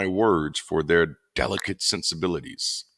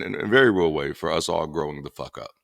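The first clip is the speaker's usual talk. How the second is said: normal talking voice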